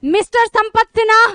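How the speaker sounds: retelling the mic calling her wrong name; mock announcer. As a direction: call the name out over a mic. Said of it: "mic से announcement की".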